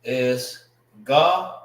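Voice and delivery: "ga-ran" slow, split into two syllables, both with an open ah; Hebrew pronunciation. Shown as a demonstration. Teacher demonstrating Hebrew "ga-ran", open ah sounds. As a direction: say "ga-ran" slowly as ga, then ran. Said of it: "how you say throat in the Hebrew".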